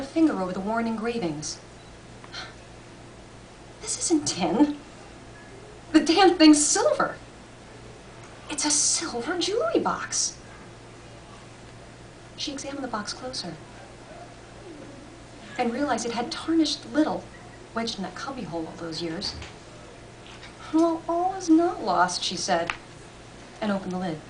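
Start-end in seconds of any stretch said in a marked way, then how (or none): 3.8-10.3 surprise. A narrator's reading. Discovering the box is silver. 20.7-22.2 slow, higher, hopeful musing. A narrator's reading. Hopeful after the discovery.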